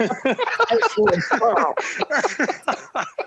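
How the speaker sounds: high, airy burst of laughter. Real, not acted. Laughing while telling the crash story. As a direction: laughs it off, breaking up.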